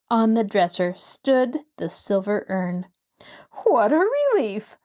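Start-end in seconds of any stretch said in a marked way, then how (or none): 3.6-4.8 high, bright burst of relief; relieved cry. A narrator's reading. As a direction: let the relief spill out higher. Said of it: "Rosemary cried".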